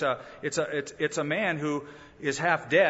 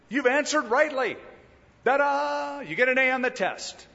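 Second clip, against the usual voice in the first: high and bright like a game show host; cheery praise; 8 semitones higher, 3 dB louder than usual. Pretend. Jesus cheerfully praising the lawyer, higher and louder. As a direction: hand him the prize with a cheer.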